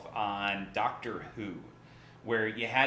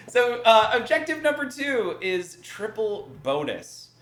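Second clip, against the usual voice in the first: laughing; 10 semitones higher, 8 dB louder, slower than usual. Real, not acted. Giggling right after the failed voice.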